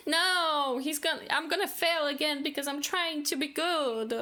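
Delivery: high, pleading, panicked monk near tears; a frightened young monk begging he cannot do it; distressed outburst. Acted. Young monk refusing his friend, high and near tears. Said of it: "I'm sorry for shouting".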